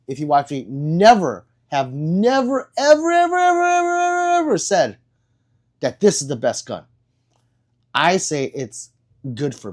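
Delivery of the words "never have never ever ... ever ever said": NEVER stretched out, then EVER repeated on a high held pitch; emphatic never. Real, not acted. Insisting he never said it.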